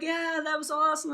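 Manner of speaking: put-on cheering, at normal volume; making fun. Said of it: "kinda just making fun".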